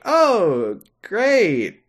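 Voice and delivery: hammed-up awkward cheer; Sora voice. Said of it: "he's like".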